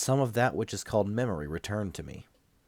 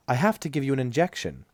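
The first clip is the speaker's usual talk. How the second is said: voiced nurse; 6 semitones higher than usual. A narrator's reading.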